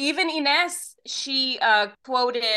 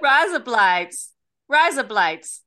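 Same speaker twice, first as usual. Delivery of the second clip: louder, slow bogan Australian twang; broad Australian accent; 6 dB louder, slower than usual. Shown as a demonstration. Saying it again for delighted co-host, bogan Australian accent. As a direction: proudly belt it out in mock Australian. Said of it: "what a true blue bogan just came out in you".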